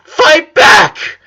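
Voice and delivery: high, loud tearful shout; furious student yelling at her sensei, tearful shout; angry cry. Acted. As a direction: fling it at him in rage. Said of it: "That made Lin a lot more angry".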